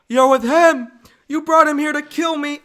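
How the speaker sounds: loud, high-pitched furious shouting; angry accusation. Acted. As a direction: shout it as a betrayed lover. Acted Anakin accuses Padme.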